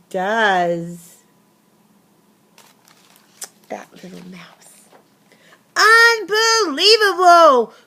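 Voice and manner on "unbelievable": high, loud, slow exasperated cry; rumbling outburst. Acted. Bear finds the mouse again, loud angry outburst. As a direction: bear can't believe it, let it burst out. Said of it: "rumbled the bear".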